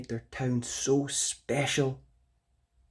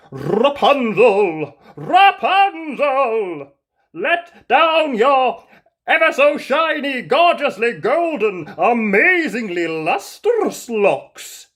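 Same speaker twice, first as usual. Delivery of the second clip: loud, slow, high, lavishing praise; grand pleading call; 12 semitones higher, 12 dB louder, slower than usual. Acted. Prince praising Rapunzel's hair to win her over, loud and slow. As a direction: pile on the flattery, calling up loud and slow. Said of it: "prince's third, grander call".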